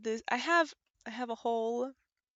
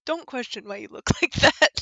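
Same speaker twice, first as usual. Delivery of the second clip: laughing loudly through the words; 2 semitones higher, 13 dB louder than usual. Real, not acted. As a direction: crack up while reading. Laughs while reading the game text.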